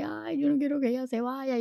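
put-on high, soft whimpering child; small plaintive whine; mock crying. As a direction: imitate little siblings pleading tearfully. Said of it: "empezaron a llorar porque".

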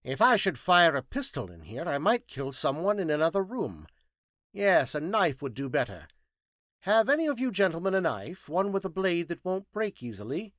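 loud, high-pitched scientist; insistent, emphatic high voice; Thinking Machine, brisk and demanding. Acted.

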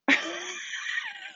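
sudden real laughing shout; burst of laughter. Real, not acted. Laughing at the retold punchline.

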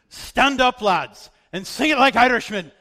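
high, loud rallying call to the lads; rousing shout. Retold or imitated. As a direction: rouse them off the floor like a pastor. Pastor rousing the crowd.

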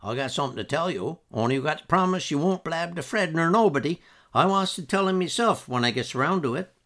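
lightly voiced sly old man; old Uncle George. A narrator's reading. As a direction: lean in like a crafty old man sharing a secret. Old uncle confides a secret.

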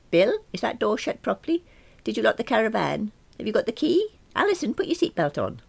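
high, rapid-fire nagging mum; fussing mother. Acted. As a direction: check everything like a worried mother hen.

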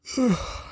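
long high sighing yawn; big yawn. Real, not acted. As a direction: let out a real tired yawn. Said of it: "so I'm a yawn".

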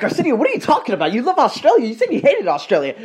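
high, quick protesting voice; high-pitched mock disbelief; imitating indignant family. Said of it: "what my brother and my family would be saying".

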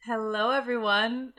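usual speaking voice

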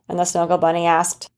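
usual speaking voice

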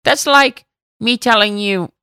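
Elmar. Retold or imitated. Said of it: "That's a good Elmar impression".

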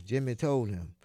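usual speaking voice

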